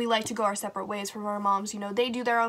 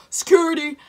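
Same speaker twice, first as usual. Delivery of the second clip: guard calling out; 6 semitones higher, 7 dB louder than usual. Retold or imitated. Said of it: "So we hear".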